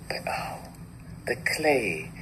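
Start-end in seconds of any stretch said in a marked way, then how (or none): 0.1-0.6 a soft voiceless "oh"; whispered sigh. Acted. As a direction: breathe the oh out with no voice.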